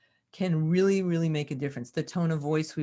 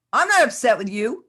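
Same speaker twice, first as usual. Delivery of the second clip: tense, louder and higher, words at odds with the tone; scary angry eyes; 6 semitones higher, 9 dB louder than usual. Shown as a demonstration. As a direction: say it kindly but with anger underneath. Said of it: "the eyes look scary and angry".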